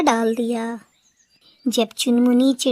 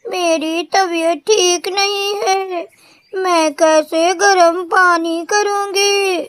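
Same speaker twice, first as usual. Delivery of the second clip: put-on higher, slow, crying old mother begging; tearful pleading; 6 semitones higher, 4 dB louder than usual. A narrator's reading. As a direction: ask why she is treated so, near tears. (बूढ़ी माँ की रोती हुई, धीमी गुहार)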